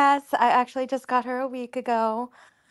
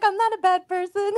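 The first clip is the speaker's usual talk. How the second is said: mock innocent plea; 9 semitones higher than usual. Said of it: "I'm, I'm like".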